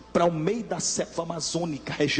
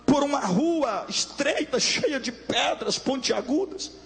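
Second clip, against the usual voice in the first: preaching that climbs higher and higher in pitch; pregação inflamada; 9 semitones higher than usual. Acted. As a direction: build the vision to a fever pitch, slowing on the images. Vision told at rising preaching pitch. (pregação cada vez mais aguda e intensa)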